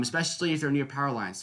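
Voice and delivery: ordinary delivery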